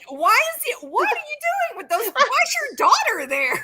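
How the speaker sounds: high, loud, baffled questions; shocked high quote. Retold or imitated. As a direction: squeak in stunned disbelief. Acting out her own reaction.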